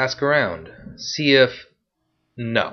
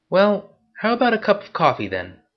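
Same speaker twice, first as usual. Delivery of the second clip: much higher, playful female voice; teasing woman; 11 semitones higher than usual. Acted. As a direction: tease him as the sly pickpocket. Woman invites Marcus.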